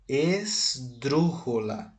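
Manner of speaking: "esdrúxula" stretched out slowly; syllable by syllable. Shown as a demonstration. Teacher naming the word's class for students, syllable by syllable. Teacher spells out the new term.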